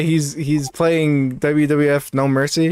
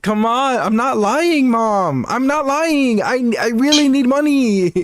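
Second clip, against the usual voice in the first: higher-pitched mock pleading; pleading to mom; 8 semitones higher than usual. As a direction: insist in a high, put-on plea. Acted pleading of streamer to mom.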